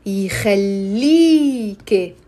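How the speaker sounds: very slow يخليك with a drawn-out "ee"; long E. Shown as a demonstration. Teacher adding the K, يخليك with long stretched E. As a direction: stretch the middle of يخليك, "yi-khal-lee-ke". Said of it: "will be pronounced as a E".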